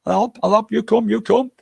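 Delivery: put-on lightly accented shopkeeper offering help; mock shopkeeper. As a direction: imitate the Thai shopkeeper. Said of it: "And he says".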